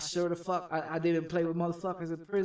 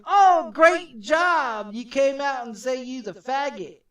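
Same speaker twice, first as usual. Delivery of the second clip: much higher, louder, slow mocking cheer; sarcastic mock praise; 7 semitones higher, 8 dB louder, slower than usual. Mocking the government for praising people who come out, slow high sarcastic cheer. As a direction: drag out fake congratulations with a sneer.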